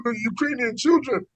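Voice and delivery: high, put-on tearful voice; mock crying. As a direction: imitate a politician crying over children. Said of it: "it was crying".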